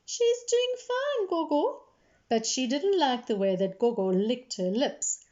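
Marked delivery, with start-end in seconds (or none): 0.0-1.8 put-on very high, slow child voice; high, drawn-out reply through a frown; frowning girl. A narrator's reading. Jamila answers Gogo about the chicken, frowning. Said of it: "Jamila frowned and said".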